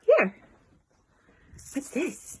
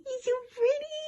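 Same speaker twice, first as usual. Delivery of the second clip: much higher cooing; 12 semitones higher, 5 dB quieter, faster than usual. Real, not acted. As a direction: lift into a high coo.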